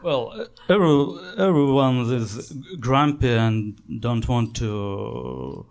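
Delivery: usual speaking voice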